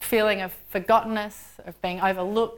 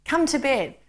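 gentle coaxing; 6 semitones higher than usual. A narrator's reading. Angel urging the tired Lord to rest, gently coaxing. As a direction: coax gently, pitched up. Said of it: "touching his sleeve gently".

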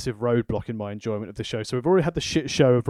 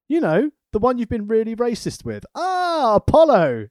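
mock writer banter; 9 semitones higher, 4 dB louder than usual. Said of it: "I was like".

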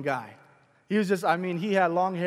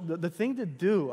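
usual speaking voice

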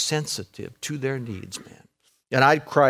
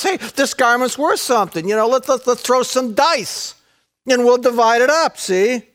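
casual mocking soldier, higher; soldiers gambling; 8 semitones higher than usual. Preacher voicing soldiers casually dividing clothes. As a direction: toss it off like a bored soldier.

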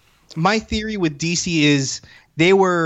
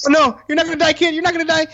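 high mock-distressed reassurance; imitating a viewer refusing to accept it; retold distress; 11 semitones higher, much faster than usual. Moviegoer to dying Spider-Man, high retold distress.

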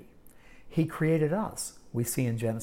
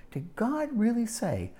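higher mocking question; sly doubting serpent; 7 semitones higher than usual. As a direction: twist the words with feigned disbelief. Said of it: "And you hear the tone of voice?".

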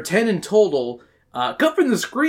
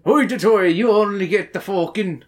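mock Scottish accent; 4 semitones higher than usual. Co-host playing the Vikings telling women off, mock Scottish accent. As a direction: burst into a silly Scottish caricature. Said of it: "That's how Scottish are".

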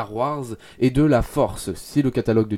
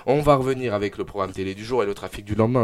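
normal talking voice

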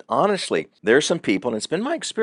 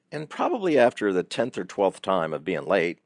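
ordinary delivery

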